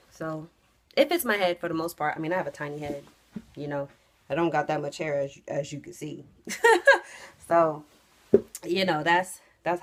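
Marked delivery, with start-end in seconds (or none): none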